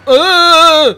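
loud, high startled grunt; startled yell. Real, not acted. Gamer crashing the bike, loud startled yelp. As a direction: yelp in alarm.

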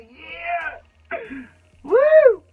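high, loud whoop of joy; real ecstatic yell; excited cheer. Real, not acted. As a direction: cheer like you just won. Ecstatic at the gloss reveal.

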